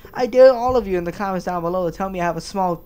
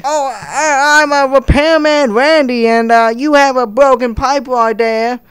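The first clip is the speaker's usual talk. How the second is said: higher, louder, slow dopey repairman; lazy repairman voice; 3 semitones higher, 8 dB louder, slower than usual. Acted. Repairman Randy loudly tells customer about broken pipe. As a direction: drawl like a useless tradesman. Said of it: "It's like me saying".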